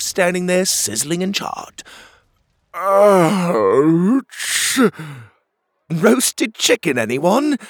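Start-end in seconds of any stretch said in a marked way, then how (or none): 2.7-4.8 loud, high yelp of pain. Acted. Burnt by dragon fire. 5.9-7.6 joking. Acted. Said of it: "Pockets joked".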